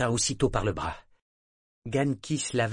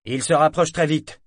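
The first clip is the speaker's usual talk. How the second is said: urgent shout; 6 semitones higher, 8 dB louder than usual. Acted. Sailor keeps shouting warning.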